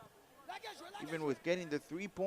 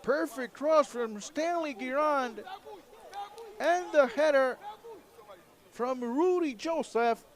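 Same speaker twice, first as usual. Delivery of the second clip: high, loud, thrilled play-by-play; excited goal call; 11 semitones higher, 4 dB louder than usual. Real, not acted.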